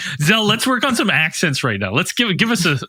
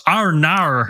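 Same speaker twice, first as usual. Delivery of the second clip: Australian accent. Shown as a demonstration. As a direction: stretch "nar" in a mock Australian accent. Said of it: "I only know that from the Wiggles".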